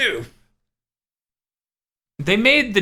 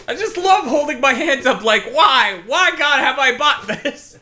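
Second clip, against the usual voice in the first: mock despair; 10 semitones higher than usual. Mock despair over the controller.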